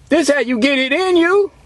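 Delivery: usual speaking voice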